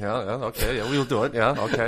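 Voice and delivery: hammed-up German accent; mock German accent. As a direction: play along as a cartoon German. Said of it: "a German accent".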